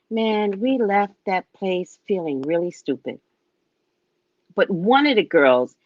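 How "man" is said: normal talking voice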